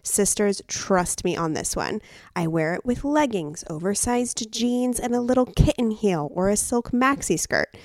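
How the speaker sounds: ad read. Acted. Host reads sponsor ad.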